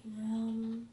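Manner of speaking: usual speaking voice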